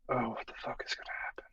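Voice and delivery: fast, hushed aside; worried whisper. Retold or imitated. Recalling dad's days in hospital, whispered worry. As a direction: whisper the retold worry under your breath.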